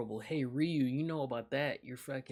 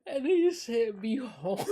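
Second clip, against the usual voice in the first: laughing so hard the words squeak out high; cracking up, barely getting the words out; 9 semitones higher, 10 dB louder than usual. Real, not acted. Streamer reading a viewer's answer, cracking up.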